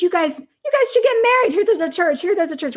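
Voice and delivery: eager group urging. Retold or imitated. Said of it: "they were like".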